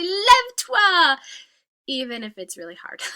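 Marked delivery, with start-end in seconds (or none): 0.0-1.2 high, loud call of lève-toi; loud command. Pretend. Teacher calling loudly to a child who is hard to wake.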